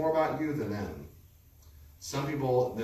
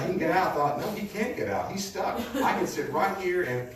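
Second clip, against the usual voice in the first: put-on higher and louder, a giddy boy gloating; gleeful childish inner voice, much higher; gleeful kid; 9 semitones higher, 3 dB louder than usual. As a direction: replay your younger self delighting in the bully's trouble. Recalling gleeful thoughts as a boy.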